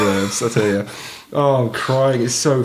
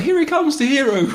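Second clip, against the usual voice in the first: high, squeaky mock cheer as he drives at them; mocking high villager voice. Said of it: "They're like".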